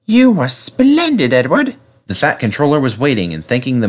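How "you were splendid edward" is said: high, drawn-out admiring character voice; grateful engine voice. A narrator's reading. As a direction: praise your rescuer in a high voice. James praising his rescuer.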